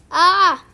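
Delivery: short loud panicked yelp; sudden shrill cry of alarm; startled yelp. Acted. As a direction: yelp as the water spreads. Panic at flooded apartment.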